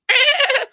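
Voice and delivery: high shrieking laugh; wild high laugh; burst of laughter. Real, not acted. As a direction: cackle out loud. Hosts cracking up at clip.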